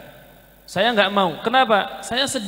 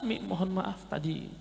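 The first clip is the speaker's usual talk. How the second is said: hushed, humble apology to his mother; soft apology; 6 semitones lower, 11 dB quieter than usual. Retold or imitated. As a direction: say sorry softly, head bowed. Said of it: "saya langsung minta maaf".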